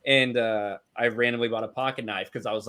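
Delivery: ordinary delivery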